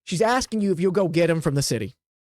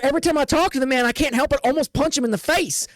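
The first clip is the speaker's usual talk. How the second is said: hurried excuse; 6 semitones higher than usual. Acted. [name] answers fast, higher.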